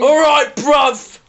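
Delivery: loud London geezer greeting; London accent. Retold or imitated. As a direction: shout it like a London lad. Echoing the London bruv impression.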